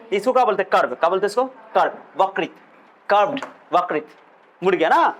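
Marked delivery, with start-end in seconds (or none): none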